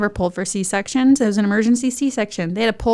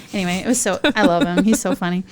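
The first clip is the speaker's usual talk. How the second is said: usual speaking voice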